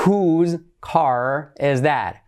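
very slow, separated words. Shown as a demonstration. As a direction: model the question slowly for learners. Slow model, then natural take. (muy lento, palabra por palabra)

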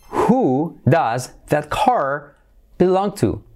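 very slow, separated words. Shown as a demonstration. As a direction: model the question slowly for learners. Slow model reading of option. (muy lento, palabra por palabra)

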